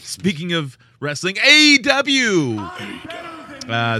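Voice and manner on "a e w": high, loud, slow hype call; announcer call. Acted. Announcing wrestling brand.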